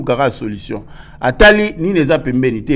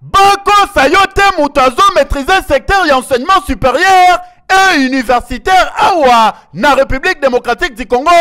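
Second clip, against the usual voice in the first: excited; 10 semitones higher, 4 dB louder than usual. Real, not acted. Reporter hyping the minister.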